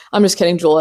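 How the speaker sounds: usual speaking voice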